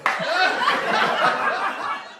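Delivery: high, breathless burst of laughter; laughing hard. Real, not acted. Host cracking up at their own bacon toastie joke, laughing hard. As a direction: crack up helplessly, no words.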